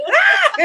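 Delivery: very high, laughing mock scream; hammed-up high-pitched yelp; imitated scream. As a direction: shriek like someone startled, laughing. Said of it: "you screamed and ran".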